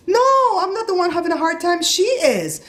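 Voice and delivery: very high-pitched, heated retort; indignant outburst. Retold or imitated. Customer to supervisor about who has the hard time, high indignation. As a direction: fire back at the top of your register. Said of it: "I was up at the top of my register".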